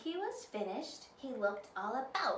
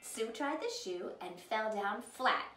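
ordinary delivery

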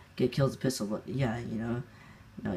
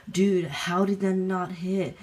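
high, slow mock outrage; imitated angry gamer; 7 semitones higher, 4 dB louder, slower than usual. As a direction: mimic an indignant sore loser, pitched up. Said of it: "he was getting mad".

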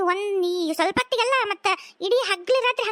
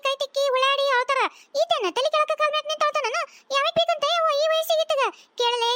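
put-on scolding old mother; 4 semitones higher than usual. Mother-in-law scolds son.

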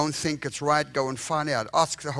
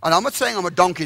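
laughing aside; 4 semitones higher, 8 dB louder, faster than usual. Real, not acted. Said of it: "Don't go, don't, don't go say".

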